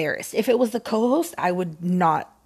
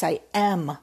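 ordinary delivery

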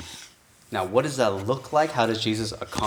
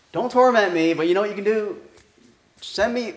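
higher, louder, wheedling demon; preacher playing a demon who bargains, higher and louder; bargaining demon; 7 semitones higher, 3 dB louder than usual. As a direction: strike a sly deal with Jesus.

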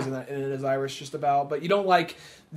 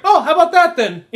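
put-on quick British accent, much higher and louder; 12 semitones higher, 10 dB louder than usual. Host mimicking the Irish character's glib remark in a British accent. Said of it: "I'm doing a British voice".

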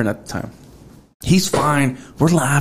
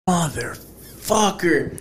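slow, drawn-out exasperated swear; retold frustrated curse; 3 semitones higher, 5 dB quieter, much slower than usual. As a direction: stretch the curse out in mock exasperation. Reacts to covid news.

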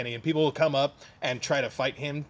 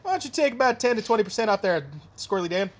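show quote; 7 semitones higher, 4 dB louder than usual. Retold or imitated. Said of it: "It's like".

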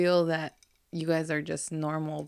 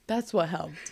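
usual speaking voice

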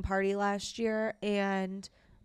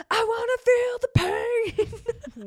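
much higher and much louder, an eerie wail; imitating a creepy child, much higher and louder; creepy kid voice; 13 semitones higher, 8 dB louder, faster than usual. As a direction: wail it like a possessed little boy. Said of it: "and he's like".

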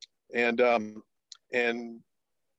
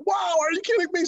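amazed wife. Retold or imitated. Wife reacting to news of the move, high amazed. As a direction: gasp it out, delighted and amazed. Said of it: "she's like".

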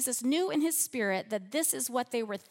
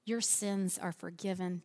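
soft and low, tender forgiveness; gentle; 3 semitones lower, 5 dB quieter than usual. Shown as a demonstration. Preacher softly speaking Jesus' words to the congregation, tender. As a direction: hush it gently like comforting a child. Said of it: "Do you hear the gentleness in that?".

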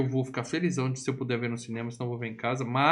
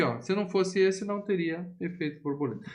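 normal talking voice

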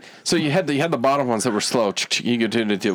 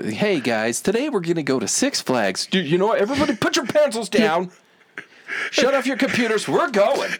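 building mock excitement; 8 semitones higher than usual. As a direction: whip up the office for the trip. Mock boss announcing Six Flags.

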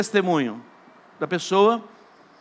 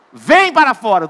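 loud command; 3 semitones higher, 9 dB louder than usual. Acted. Jesus commands Lazarus.

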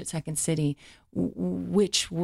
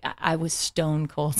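normal talking voice

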